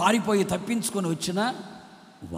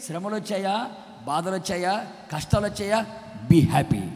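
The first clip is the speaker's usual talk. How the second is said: ordinary talk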